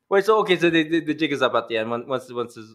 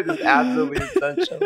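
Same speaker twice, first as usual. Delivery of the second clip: mock dramatic quote. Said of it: "Who talks like this?".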